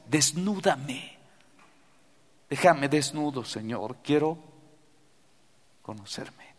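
hushed confession prayer. Acted.

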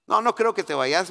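lightly voiced wife pushing back; wife protesting. Wife opposing his leaving. (esposa objetando, algo más fuerte)

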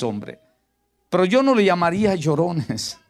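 normal talking voice

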